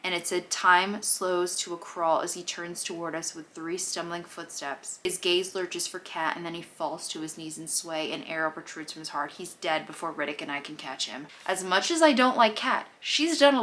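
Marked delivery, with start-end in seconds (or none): 0.5-11.3 lower, even book-reading voice; reading aloud. Acted. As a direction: read the passage out like a narrator. Reads passage from the book aloud.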